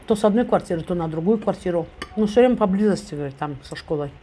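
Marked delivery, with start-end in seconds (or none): none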